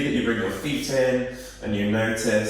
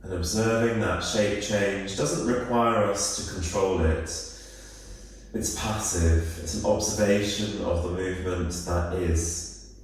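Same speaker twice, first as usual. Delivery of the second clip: calm, unhurried body-scan voice with long pauses; guided meditation; 3 dB quieter than usual. Real, not acted. Yoga teacher guiding listeners through a body scan, calm guided meditation. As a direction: ease them into stillness, phrase by phrase.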